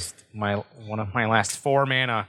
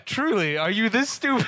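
incredulous look voiced; 9 semitones higher than usual. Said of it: "one of those looks of, like".

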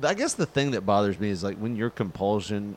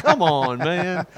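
higher, louder mock whine; whiny coworker; 7 semitones higher, 5 dB louder, much slower than usual. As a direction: complain like the annoyed coworker. Coworker whining.

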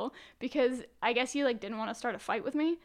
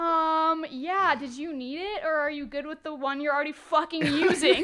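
sarcastic retort; 3 semitones higher, 5 dB louder than usual. Retold or imitated. Mock retort to rude old man.

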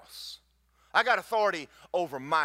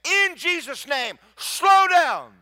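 slow, much louder and higher cry; commanding shout; 12 semitones higher, 11 dB louder, slower than usual. Retold or imitated. Preacher commands the hurricane to slow down, shouting. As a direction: rebuke the storm with everything you have. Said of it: "And I said".

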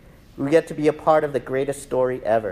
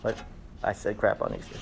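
sheepish aside; 7 semitones lower, 7 dB quieter, faster than usual. Real, not acted. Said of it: "aside after saying crap".

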